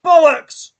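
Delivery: loud, high furious swearing; angry shout. Real, not acted. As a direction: yell it in outrage. Fan yelling at the referee.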